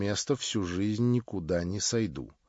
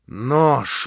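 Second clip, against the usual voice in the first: voiced, louder bark; spoken in fury; 3 semitones higher, 8 dB louder than usual. A narrator's reading. Stavrogin repeats it aloud in rage. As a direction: spit the word out aloud. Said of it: "повторял он в неутолимой злобе".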